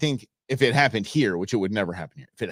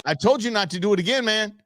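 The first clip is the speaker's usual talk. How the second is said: raised, mock-stern warning; scolding guard; 7 semitones higher than usual.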